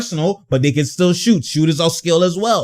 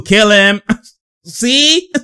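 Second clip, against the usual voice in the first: ordinary talk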